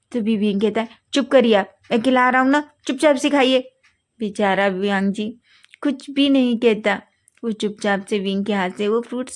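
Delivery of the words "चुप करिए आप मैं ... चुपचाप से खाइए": bossy scolding. A narrator's reading. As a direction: snap at him to hush and eat. Wing forces Wang to eat.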